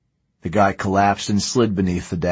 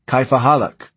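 higher, louder foreign challenge; calling out; 6 semitones higher, 5 dB louder than usual. A narrator's reading. Driver standing in his Jeep hails the strangers, calling out. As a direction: call it out across to strangers.

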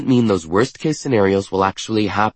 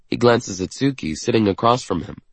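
usual speaking voice

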